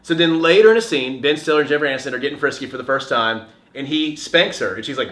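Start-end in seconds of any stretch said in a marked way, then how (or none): none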